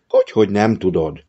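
ordinary delivery, nothing marked

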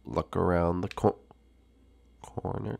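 normal talking voice

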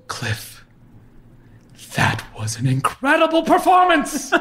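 high, theatrical praise, name breathed; drama teacher voice. Retold or imitated.

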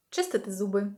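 ordinary talk

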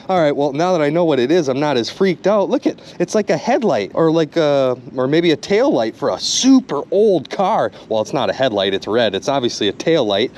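ordinary talk, nothing marked

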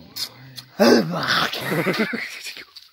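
high rising laugh. Real, not acted. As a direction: laugh with delight. Laughing after tasting.

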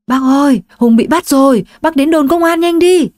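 high, loud, hurried plea; urgent phone call. Acted. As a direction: rush the bad news out. Friend phones with bad news.

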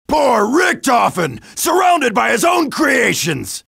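ordinary talk

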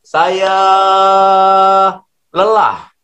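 high mock yell of "lelah"; shouted into a cliff. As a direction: call it out to the cliff. (teriakan pura-pura ke tebing)

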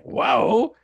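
high, loud retold whoa; astonished. Teller relives hearing Austria's claim, amazed. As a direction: let amazement jump up in the voice. Retells his stunned reaction to claim.

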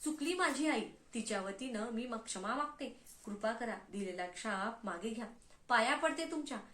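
plain reading voice

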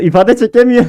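high, loud mocking whine; mock pity. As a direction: pity him theatrically. Mocking opponent who can't emote.

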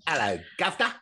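high, loud hammed-up English accent; badly faked English greeting, loud and high; mock English accent. Joking before English murder stories, mock English accent. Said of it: "trying to do an English accent".

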